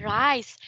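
Rice ending on a crisp S; clear S sound. Shown as a demonstration. Teacher corrects Min, stressing the S. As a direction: lean on the final S of Rice. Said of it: "emphasize the S sound".

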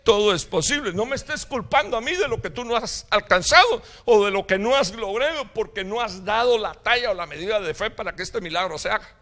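loud, high, indignant preaching; forceful divine reproof. Retold or imitated. God answers the doubting father, loudly indignant. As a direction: hurl it back as an indignant rebuke. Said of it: "La contesta de Dios fue".

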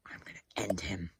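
under the breath threat; whispered threat. Real, not acted. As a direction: whisper it menacingly. Muttered threat aside.

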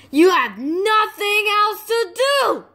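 high, exasperated scolding; angry. Acted. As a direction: snap at him.

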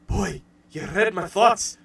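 slow cartoon Scottish accent; Groundskeeper Willie's Scottish voice. Willie confiding in the boy, Scottish accent. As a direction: draw it out in a mock Scots burr. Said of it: "Groundskeeper Willie, and he's just like".

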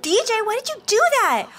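high, loudish whine of complaint; mock protest. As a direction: act out an indignant sister. Voices Sim protesting snowball hit.

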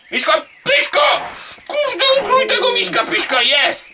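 high, squealing yell; frantic shout. Real, not acted. Gamer attacking the teddy character, frantic shout.

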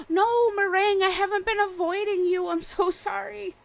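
high-pitched gushing apology to a game villager; high excited pleading. Real, not acted. Streamer apologizing to her neglected villager, high and pleading.

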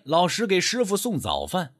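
ordinary delivery

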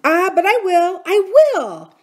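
high, loud vow of resolve; determined outburst. Acted. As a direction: insist fiercely that you will.

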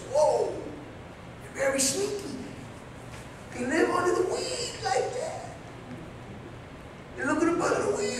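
soft, awed murmur; a man in the woods softly describing hidden little people; hushed amazement. Retold or imitated. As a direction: tell it like you're hiding and watching. Retelling first sighting of pygmies.

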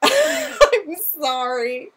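loud, high laugh into a drawn-out sorry; real laughing, stretched-out apology. Real, not acted. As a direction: apologise through a big relieved laugh. Laughing off the scare she caused.

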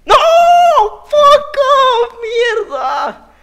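very high, very loud anguished screech; furious scream. Real, not acted. Gamer dying at the last jump, screaming very high and loud. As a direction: scream out the loss at full force. Gamer dies near the goal.

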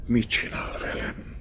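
sighed out, nearly voiceless; plaintive sigh. Acted. Countess sighing plaintively as Franci kisses her. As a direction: sigh it as a soft complaint. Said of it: "sóhajtotta a grófné panaszosan".

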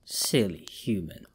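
low, slow hiss of a monster; hissed creature voice. A narrator's reading. Creature hissing at the trapped man. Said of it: "it hissed again".

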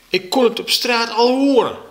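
high, indignant yell; schreeuwen. A narrator's reading. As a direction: yell it in protest. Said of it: "Niet schreeuwen". (verontwaardigd schreeuwend)

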